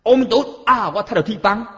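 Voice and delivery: high-pitched mock outcry; acted dismay. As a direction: exclaim as if hit by bad luck. Acting someone exclaiming at a mishap. (假作哀一声)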